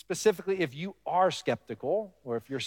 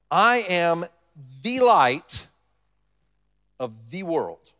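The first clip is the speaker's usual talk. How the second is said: "the light" raised and louder, set apart by pauses; stress on THE; 5 dB louder, much slower than usual. Shown as a demonstration. Preacher quoting Jesus's claim, stressing "the" in "the light". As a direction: lean hard on "the" before "light", then drop for "of the world". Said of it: "I put the emphasis on the".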